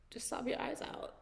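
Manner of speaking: a soft whispered repeat of the advice. Acted. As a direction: whisper "Just sob your eyes out" with breath, no voice.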